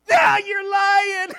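high, loud, drawn-out whine; imitated disbelieving kids. Junior highers refusing to believe pastor, high shouted protest. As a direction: cry out a kid's shrieked protest.

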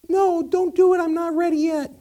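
high, loud panicky candle; little voice. Acted. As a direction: plead loudly in a tiny high voice. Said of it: "this little voice say".

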